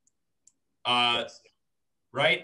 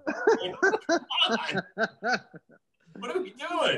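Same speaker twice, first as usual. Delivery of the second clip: laughing exasperation; 12 semitones higher than usual. Real, not acted. Comic exasperation.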